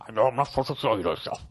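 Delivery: rushed, bad presidential impression; Nixon. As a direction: put on a hammy president's voice, hurried. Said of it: "That was a bad Nixon impression".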